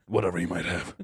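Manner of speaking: low, soft dismissive murmur; low quiet Walter. Retold or imitated. Walter dismissing the girl, low and quiet. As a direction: brush it off coldly.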